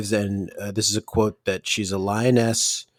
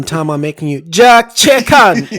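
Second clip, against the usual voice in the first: mock announcement; 4 semitones higher, 6 dB louder than usual. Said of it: "jokes about faking an accent".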